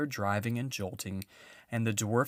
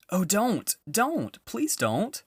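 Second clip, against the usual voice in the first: high, louder desperate pleading; shouted plea; 10 semitones higher, 5 dB louder, slower than usual. A narrator's reading. Edmund begging the witch not to strike, shouted plea. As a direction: beg her to stop. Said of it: "shouted Edmund".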